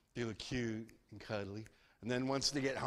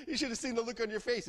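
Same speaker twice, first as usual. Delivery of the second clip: laughing tease; 9 semitones higher, faster than usual. Acted. Sklep laughing.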